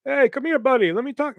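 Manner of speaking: high-pitched hustler calling someone over; pushy kiosk seller. Retold or imitated. Kiosk seller hailing mall shoppers, pushy and familiar. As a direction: imitate a salesman buttonholing a passerby. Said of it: "one of those type of fucking people".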